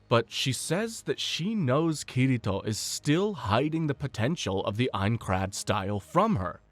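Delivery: ordinary talk, nothing marked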